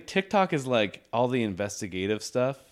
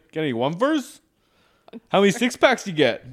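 higher, louder Canadian questioning; nosy Canadian lilt; Canadian guard; 7 semitones higher, 6 dB louder than usual. Pretend. Guard quizzing traveller, higher Canadian accent.

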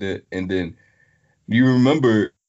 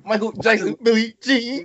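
high hype announcer voice; 13 semitones higher, 3 dB louder than usual. Retold or imitated. Announcing the song like a hype man.

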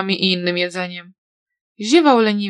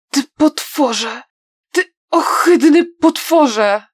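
slow, louder, higher, voice breaking into tears; furious sobbing cry; 5 semitones higher, 4 dB louder, slower than usual. A narrator's reading. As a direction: spit out the insult through grief and rage. Songlan breaks in rage, trembling.

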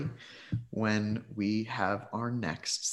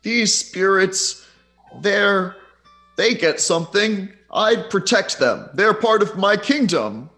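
high, loud proud spirit king; unearthly voice; 11 semitones higher, 6 dB louder than usual. Acted. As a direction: defend your kingdom proudly. Spirit defends its kingdom.

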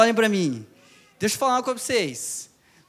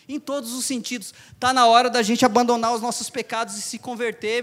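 ordinary delivery

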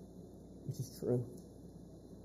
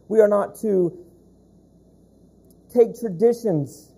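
louder, pounding pulpit delivery; full-force preaching; 5 semitones higher, 7 dB louder than usual. Acted. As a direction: preach it hard to the congregation.

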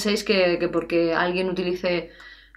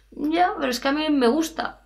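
stubborn student; 7 semitones higher than usual. Pretend. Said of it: "Es como si me dices".

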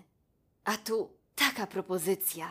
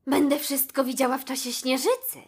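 higher, louder happy giraffe voice; excited giraffe; 7 semitones higher, 8 dB louder than usual. A narrator's reading. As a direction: gush about the gift as Bibi. Giraffe thrilled by her gift. (podekscytowana żyrafa, wyżej i głośniej)

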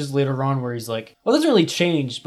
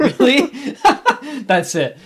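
laughing; 10 semitones higher, 3 dB louder than usual. Real, not acted. Amused reaction to Tucci story.

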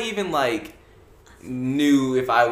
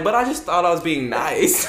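laughing protest; 5 semitones higher than usual. Retold or imitated. Teen to seatmates who guessed, laughing protest. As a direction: protest innocence through a laugh.

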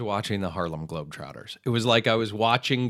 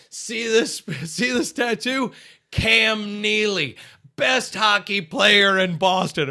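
much higher, slow, swaggering tattoo brag; slow, proud drunk fan voice, pitched up high; drunk Boston sports fan; 10 semitones higher, 4 dB louder, slower than usual. Retold or imitated. Ike as the drunk fan shows a new tattoo, slow and swaggering. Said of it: "he pulls up another part of his shirt and he goes".

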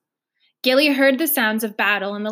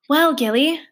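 falsely bright; 5 semitones higher, 5 dB louder than usual. Acted. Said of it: "her voice glittering like a fake Christmas tree".

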